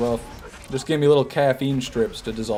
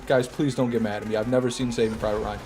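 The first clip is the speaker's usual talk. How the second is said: ordinary delivery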